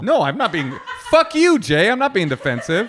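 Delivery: loud, high, laughing protest; mock outrage with a laugh in it; mock-defensive outburst. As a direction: playfully blow up at being called defensive. Mock quarrel after being called defensive.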